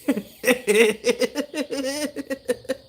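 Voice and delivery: high giggling laugh; real laughter. Real, not acted. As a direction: crack up at his own joke. Laughing at own impression.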